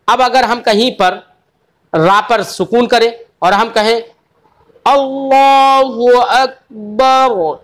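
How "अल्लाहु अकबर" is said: very slow, drawn-out chant; Quranic recitation. Shown as a demonstration. Teacher showing the pause, very slowly recited. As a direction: stretch अल्लाहु Akbar and stop on the रा. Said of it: "तो रा साकिन हो गई है".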